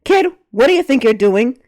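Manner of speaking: put-on loud booming shout at a kid; angry father figure booming at the child. A narrator's reading. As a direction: boom it across the room. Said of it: "J R's voice boomed".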